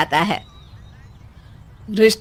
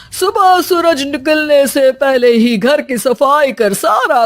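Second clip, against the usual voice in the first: pompous baba. Acted. Baba prescribes again.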